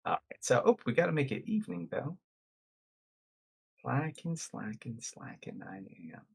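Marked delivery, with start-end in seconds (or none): 3.8-6.2 fading out. Real, not acted. Fading repeated word to whisper.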